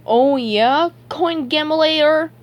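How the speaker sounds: high, loud taunt; childish accusing jab; accusingly. A narrator's reading. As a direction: draw out the name as an accusation. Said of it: "SpongeBob said accusingly".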